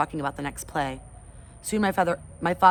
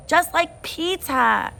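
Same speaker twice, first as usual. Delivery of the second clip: put-on high, slow toddler sing-song; proud toddler; 12 semitones higher, 6 dB louder, much slower than usual. Toddler Fudge showing off on the bike, slow and high. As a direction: savour it like a proud little boy.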